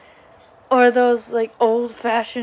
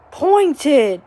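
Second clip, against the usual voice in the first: ordinary delivery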